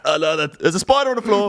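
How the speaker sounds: sudden high alarmed cry; startled by a spider on the floor. Real, not acted. Spotting a spider mid-quiz.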